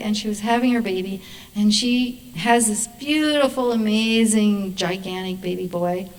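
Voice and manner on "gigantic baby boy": ordinary talk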